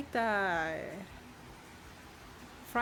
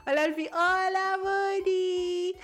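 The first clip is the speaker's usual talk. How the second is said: excited greeting; 7 semitones higher, 4 dB louder than usual. Real, not acted. Greeting chat viewers excitedly.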